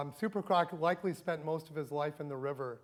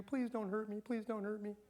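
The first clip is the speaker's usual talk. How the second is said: small, high, softer pleading voice; mock begging; 5 semitones higher, 6 dB quieter than usual. Teacher voicing Leviathan begging a human for mercy, mock-pleading. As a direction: plead meekly, higher and softer, in pretend fear. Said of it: "Will he beg you".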